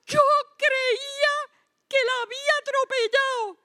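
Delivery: voz de mujer espantada, mucho más aguda; señora asustada. Retold or imitated. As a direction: imita a la señora todavía temblando del susto. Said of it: "la señora estaba más asustada que yo". (señora asustada, voz muy aguda)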